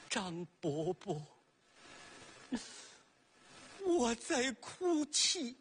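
slow, drawn-out ghostly lament; mournful ghost. Acted. Ghost pot tells old Zhang it is weeping, slow lament. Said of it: "就听这盆儿说".

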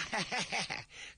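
high, falling embarrassed chuckle; awkward laugh. Acted. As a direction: laugh it off nervously. (尴尬的干笑)

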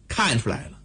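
plain reading voice

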